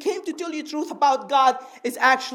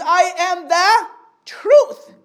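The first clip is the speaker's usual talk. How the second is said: slow, raised, louder declaration, pausing before "truth"; deliberate, ringing proclamation; emphatic quote; 5 semitones higher, 6 dB louder, much slower than usual. Retold or imitated. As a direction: stretch "the" high and loud, then land "truth".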